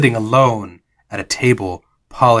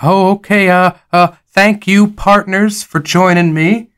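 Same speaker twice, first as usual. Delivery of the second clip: higher, halting game character; lightly voiced Jonas; 6 semitones higher than usual. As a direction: stammer out thanks to your companions.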